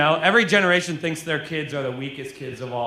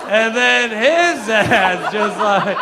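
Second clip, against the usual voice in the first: "his" stretched long with a swooping rise; drawn-out stress on HIS; 3 semitones higher, 5 dB louder, much slower than usual. Real, not acted. Comic going back another generation of dads, "his" loudly stretched. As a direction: lean hard and loud on "his", dragging it out. Running bit, escalating lead-in.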